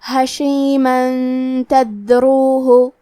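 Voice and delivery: حشیمن تدروہ very slow, tanween نون hidden in a nasal hum into ت; ghunnah demo. Shown as a demonstration. Teacher showing ikhfa to students, slow nasal حشیمن تدروہ. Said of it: "یہ حکم کو غنہ کے ساتھ ادا کریں گے".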